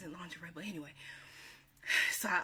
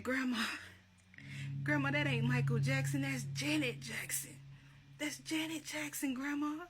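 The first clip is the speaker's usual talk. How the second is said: exasperated correction; 7 semitones higher than usual. Retold or imitated. Correcting grandma in retold exchange.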